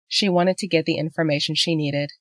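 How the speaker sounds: ordinary delivery, nothing marked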